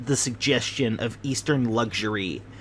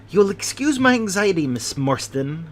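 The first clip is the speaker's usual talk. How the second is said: airy, careless, a touch higher; airily; 7 semitones higher than usual. Acted. Host excuses his fuss to Miss Morstan, airy and careless. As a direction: brush it off lightly, as if nothing. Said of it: "he remarked airily".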